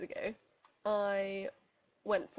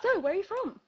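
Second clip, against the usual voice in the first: high, louder small-talk opener; chatty stranger; 9 semitones higher, 7 dB louder than usual. Retold or imitated. Stranger starting small talk mid-run, high mock-chirpy. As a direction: imitate a breezy, chatty runner.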